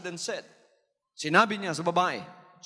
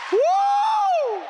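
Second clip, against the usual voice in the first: high loud whooping laugh; joyful shout laugh; 9 dB louder than usual. Real, not acted. Preacher celebrating, high loud woo. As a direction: whoop with delight. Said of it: "preacher's joyful outburst".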